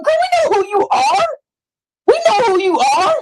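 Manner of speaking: high, laughing mockery; high laughing put-down of a rival; laughing taunt. Real, not acted.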